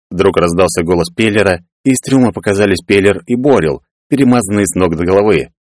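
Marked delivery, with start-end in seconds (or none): none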